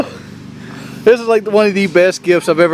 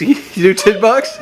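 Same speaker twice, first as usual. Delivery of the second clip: asking through laughter; laughing; 8 semitones higher than usual. Real, not acted. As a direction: laugh while you ask. Laughing offer.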